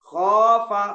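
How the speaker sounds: खौफा called out loud, the au held; recited khaufa. Shown as a demonstration. Teacher reciting खौफा for the class. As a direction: stretch the au of खौफा. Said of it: "अब मैं दो चार हर्फ पढ़ के सुनाता हूँ".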